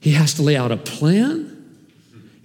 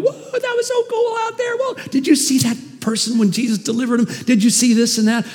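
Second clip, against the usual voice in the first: excited disciples; 9 semitones higher than usual. Retold or imitated. Disciples high-fiving after mission.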